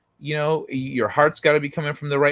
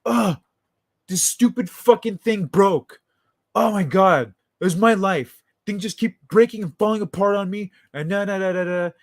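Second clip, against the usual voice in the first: higher, louder, hammed-up tantrum; mock furious rant; 5 semitones higher, 3 dB louder than usual. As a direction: imitate a man cursing the thing he broke. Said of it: "He's like".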